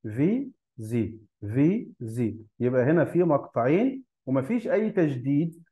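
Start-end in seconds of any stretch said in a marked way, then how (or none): 0.0-2.4 "في، زي" slowly, split into two separate beats; syllable by syllable. Shown as a demonstration. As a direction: break the word into "في" and "زي", pausing between them. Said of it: "مكون من two syllables".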